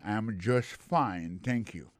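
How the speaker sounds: slow English demo. Shown as a demonstration. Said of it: "plusieurs façons de répondre".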